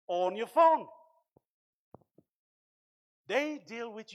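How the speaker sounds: loud, rising sermon delivery; preaching at full force. Acted. Pastor preaching to the church about willpower, fervent and loud. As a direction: drive the message home to the congregation.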